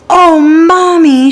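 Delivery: put-on high, slow, thrilled child voice; a child spotting the bear she always wanted; excited little girl. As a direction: gasp it out like a delighted little girl. Girl sees her dream bear.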